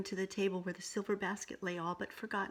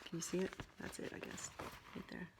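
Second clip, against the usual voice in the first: hushed pointing out; quiet aside; 11 dB quieter, faster than usual. Real, not acted. Reader to child, softly pointing at the page.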